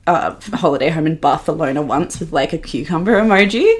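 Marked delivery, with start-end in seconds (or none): none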